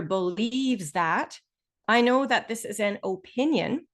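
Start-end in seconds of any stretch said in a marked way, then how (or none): 0.0-1.4 "believes" stretched out very slowly; stress on BELIEVES. Shown as a demonstration. Teacher picking out the signal word for students, slow emphatic "believes". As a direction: drag out "believes" before "that". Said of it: "So as soon as I hear".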